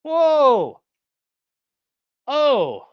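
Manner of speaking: high, loud drawn-out whoa; genuinely taken aback; shocked. Real, not acted. Shocked reaction to tweet.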